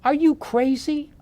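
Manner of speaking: wife asking in disbelief; retold shocked "are you crazy"; incredulous wife. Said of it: "And she said".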